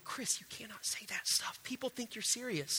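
hushed warning whisper, quoting his wife; wife's warning whisper. Retold or imitated. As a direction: whisper the wife's scolding under your breath. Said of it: "my wife was like".

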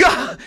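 short startled yelp of fright; a startled gasp-shout at a sudden angel. Retold or imitated. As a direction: jump out of your skin with an Ah. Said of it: "And Gideon's like".

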